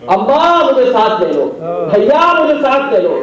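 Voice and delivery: put-on high, pleading wail of a little girl; lightly voiced child begging; child's pleading cry. Child Sakina begging not to be left.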